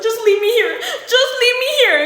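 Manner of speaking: high, loud whiny plea; hammed-up tearful wail; mock crying. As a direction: imitate a boyfriend bawling to be left. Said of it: "He was crying".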